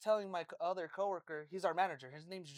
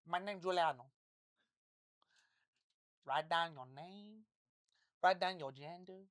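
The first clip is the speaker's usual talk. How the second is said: mock Portuguese accent; 3 semitones lower than usual. Showing friends the manager's voice, mock Portuguese accent. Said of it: "that's his Portuguese".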